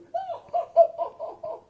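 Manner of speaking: loud, high-pitched cackling laugh; mocking lady's laugh. Lady laughing at the soaked moose, imitated high laugh. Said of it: "And then this lady goes".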